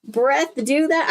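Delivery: high, sneering puppet voice; high mocking dummy, dragging out the last word; made-up dummy voice. A narrator's reading. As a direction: taunt them in a silly put-on voice. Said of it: "I don't know what voice I'm doing".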